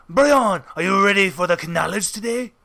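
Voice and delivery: put-on Spanish accent, knowledge as kuh-NOW-ledge; imitated Spaniard's accent, sounding the K in knowledge; David. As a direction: hail them in a mock Spanish accent. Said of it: "this is what he would always say to me".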